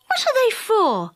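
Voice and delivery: put-on high, curious boy's question; eager boy. A narrator's reading. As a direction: ask wide-eyed like a small boy. Dick asks the goblin.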